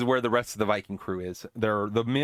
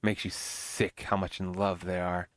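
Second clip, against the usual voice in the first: lower, softer, slow witchy sneer; witch sneer; 6 semitones lower, 6 dB quieter, slower than usual. Retold or imitated.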